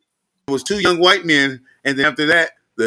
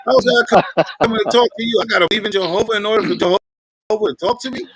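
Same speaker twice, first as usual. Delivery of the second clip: high, animated questioning; a laughing, high incredulous push-back; mock exasperated challenge; 8 semitones higher, 3 dB louder than usual. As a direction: needle him with rapid rhetorical questions. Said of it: "I'm like".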